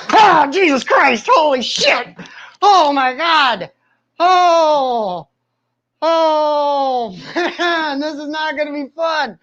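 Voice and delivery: high, strained cries of pain mixed with laughter; real pain, laughing and crying out. Real, not acted. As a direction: yelp through the shock. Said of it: "that hurts so bad".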